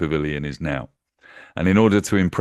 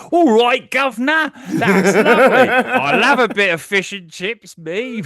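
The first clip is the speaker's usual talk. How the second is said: much higher, loud, hammed-up Cockney cheer; mock Cockney; 8 dB louder, slower than usual. Woman mocking the man with a hammed-up Cockney accent. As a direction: camp it up like a cartoon Cockney. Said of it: "And she says".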